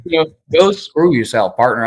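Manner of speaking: put-on a light, half-hearted Texas accent. Lawyer jokingly voicing a Texan brush-off, light Texas accent. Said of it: "I can't do a Texas accent".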